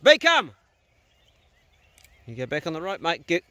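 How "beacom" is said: high, loud call across the field; calling out to the dog. Real, not acted. Farmer sending his dog after stock, high loud call. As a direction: shout the dog's name to send him on.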